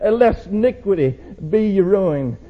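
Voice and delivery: shouted altar call, rising high; fiery preaching. Acted. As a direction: thunder the call to repent. Preacher surges into exhortation.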